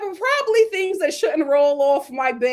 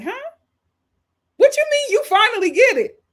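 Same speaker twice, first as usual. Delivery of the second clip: high, loud indignant comeback; reenacted outraged retort; heated; 7 dB louder than usual. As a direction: fire back in heated disbelief. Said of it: "I was so heated".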